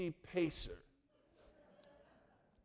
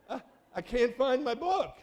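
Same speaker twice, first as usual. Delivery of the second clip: imitating a flustered caller, higher and louder; raised, exasperated stammer into the phone; 9 semitones higher, 7 dB louder than usual. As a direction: act out mild panic, pitched up and loud. Retold caller flustered over lost book.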